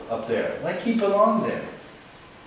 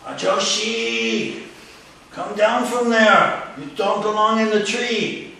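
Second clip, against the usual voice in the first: slow, loud police order up the tree; commanding police captain; 5 semitones higher, 5 dB louder, slower than usual. Retold or imitated. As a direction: play the captain barking at the man. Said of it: "captain immediately took charge".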